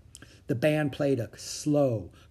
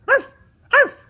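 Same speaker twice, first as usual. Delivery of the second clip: short, high dog yips; two separate high barks; dog barking; 12 dB louder than usual. A narrator's reading. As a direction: imitate a little dog's bark. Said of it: "The barking came from a distance".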